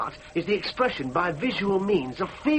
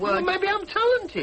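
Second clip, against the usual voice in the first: high, stretched-out retort; defiant retort; 12 semitones higher, slower than usual. Acted. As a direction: stand up to them, voice climbing high. Son defies belittling parents.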